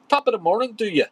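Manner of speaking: high, loud stage-Irish greeting; Irish accent. Acted. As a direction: try out a hearty Irish accent. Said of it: "That's, is that Irish?".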